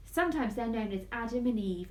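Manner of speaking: ordinary delivery, nothing marked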